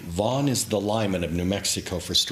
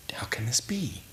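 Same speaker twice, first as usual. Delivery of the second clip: whisper; 3 semitones higher, 6 dB quieter than usual. Retold or imitated. Child's wondering inner thought.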